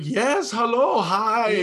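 high, loud put-on Swedish greeting; hammed-up Swedish DJ voice, laughing through it; fake Swedish accent. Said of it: "fake Swedish accents".

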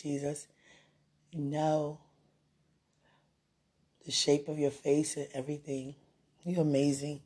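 softly spoken, heartfelt reflection; tender, reverent. Real, not acted. Speaker reflecting on God's care, tender and reverent.

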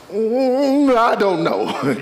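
long high hum falling into slow words; leaned-back reply. Shown as a demonstration. Preacher shows how to answer pressuring people, leaned-back and drawn out. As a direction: lean back and draw it out, unhurried. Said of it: "lean back and say".

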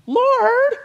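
shrill high mock whine; whiny Martha. Martha scolding Jesus from the kitchen, high whine. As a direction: whine up high like an exasperated hostess. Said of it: "I don't know if that's what she sounded like".

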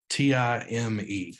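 "T I M E" spelled slowly, letter by letter. Shown as a demonstration. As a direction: lay out "T I M E" one letter at a time. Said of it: "just those four letters there".

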